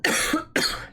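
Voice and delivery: real double cough; brief throat-clearing cough; short cough. Real, not acted. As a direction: cough twice, quick and unvoiced. Streamer coughing with laryngitis.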